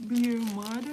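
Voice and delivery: ordinary talk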